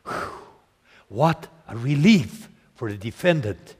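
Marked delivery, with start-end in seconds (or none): none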